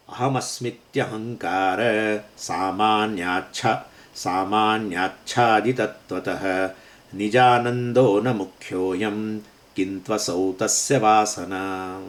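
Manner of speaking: slow, measured chanting of a Sanskrit shloka; slow verse recitation. Shown as a demonstration. Teacher reciting the ninety-sixth verse to students, slow and measured. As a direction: intone each word slowly, like scripture. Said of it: "છન્નુમા શ્લોક".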